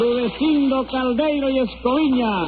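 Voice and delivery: high, drawn-out roll call; singsong high summons; court crier calling names. Acted. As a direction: call the name out slowly across the courtroom. Court crier calls the parties.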